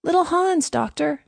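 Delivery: high-pitched answering call. A narrator's reading. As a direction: call your name up to the doctor. Calling up to the doctor's house.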